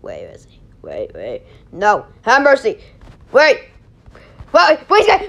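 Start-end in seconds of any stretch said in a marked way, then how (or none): 0.1-1.4 whisper. Real, not acted. Tense moment pulling a block.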